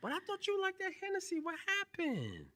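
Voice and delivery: high, teasing mock surprise; mock surprised teasing. Real, not acted. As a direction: tease her in a squeaky voice. Teasing friend about the drink.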